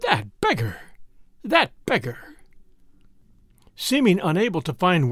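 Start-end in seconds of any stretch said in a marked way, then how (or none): 0.0-0.8 exasperated mutter, pitched low; exasperated wife. A narrator's reading. Narrator voices the wife repeating her insult, exasperated. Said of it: "His exasperated wife kept repeating". 1.4-2.4 exasperated repeat, pitched higher; exasperated wife. A narrator's reading. Narrator voices the wife repeating her insult, more sharply exasperated. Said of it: "His exasperated wife kept repeating".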